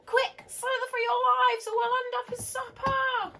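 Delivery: high, loud and urgent; panicked snails. Acted. Snails fleeing the bird, loud high panicked urging. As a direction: hurry everyone away in a fright. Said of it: "cried the snails in panic".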